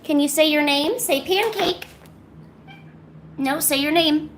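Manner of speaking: high singsong call to the cat; pet talk. Real, not acted.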